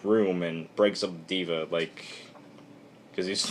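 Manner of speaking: ordinary delivery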